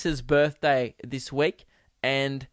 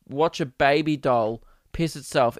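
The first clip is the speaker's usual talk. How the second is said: normal talking voice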